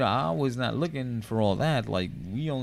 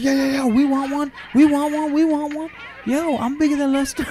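put-on much higher, louder kids chanting; mock kids clamoring; 8 dB louder than usual. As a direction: shout like greedy children. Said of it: "they're like".

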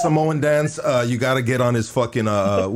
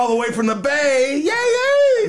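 high holler rising to a very high whoop; hammed-up announcer whoop; 10 semitones higher than usual. Host mocking a caller from the Bay, hammed-up whoop. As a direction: build to a squealed, mocking cheer.

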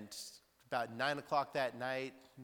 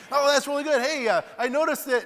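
eager neighbor chatter; 14 semitones higher, 15 dB louder than usual. Retold or imitated. Neighbor keeps chatting loudly.

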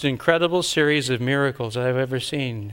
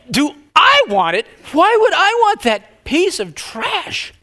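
much higher, louder, slow disbelief; indignant scorn; 7 dB louder, slower than usual. Retold or imitated. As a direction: recoil at the question. Indignant reply to God.

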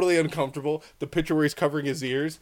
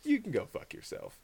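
muted retort; 7 semitones higher, 11 dB quieter than usual. Retold or imitated. Mock retort to partner.

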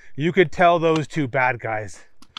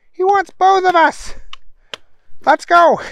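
very high, loud comic villain; high henchman voice; 7 dB louder than usual. A narrator's reading. Bad guy pressing his partner to go, high henchman voice. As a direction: squeak it urgently to a partner. Bad guys answer the call.

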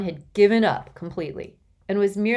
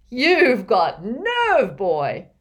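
slow, booming laugh-filled voice; 6 semitones higher, 8 dB louder, much slower than usual. A narrator's reading. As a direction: boom it out with delight. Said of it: "he boomed".

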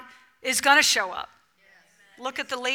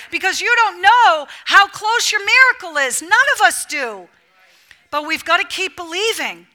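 loud, high, driving sermon; full-force preaching; 4 semitones higher, 8 dB louder than usual. Acted. Preacher rallying the congregation to keep believing, loud and driving. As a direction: preach it hard to the room.

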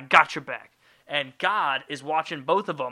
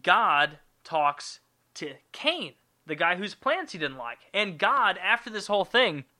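ordinary delivery

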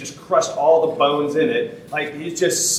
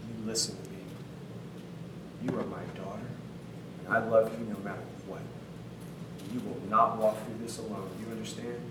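low, hushed and much softer, close to the ear; tender whisper; 3 semitones lower, 10 dB quieter than usual. Retold or imitated. Father hushes his pregnant daughter on his lap, tender whisper. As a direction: murmur it gently as if holding a crying child. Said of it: "and whispers in her ear".

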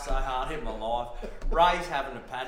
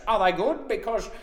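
higher, louder eager bloke voice; earnest Ray; 7 semitones higher, 5 dB louder than usual. Retold or imitated. Ray at smoko asking Steve where he got the pump, eager and higher. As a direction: ask it keen and innocent.

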